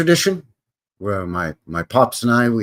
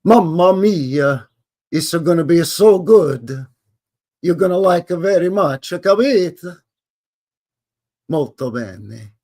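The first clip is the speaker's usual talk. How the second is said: slow, hammed-up Italian accent, higher and louder; mock Italian accent; 5 semitones higher, 5 dB louder, slower than usual. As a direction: play the jolly Italian host in a thick Italian accent. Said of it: "this time we're going to an Italian place".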